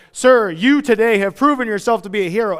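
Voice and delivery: mock-formal public tribute; hero tribute. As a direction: proclaim it like an award presenter.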